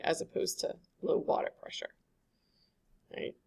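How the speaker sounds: breathy whispered aside to the class; a quick whispered contrast. Real, not acted. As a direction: drop to a whisper for the comparison. Whispered aside during lecture.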